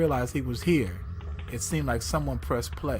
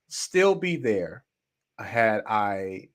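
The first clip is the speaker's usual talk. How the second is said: ordinary delivery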